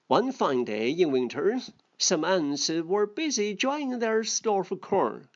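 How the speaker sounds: a lightly voiced storybook reading; story reading. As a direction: read the fable aloud to the class. Second read of the fable.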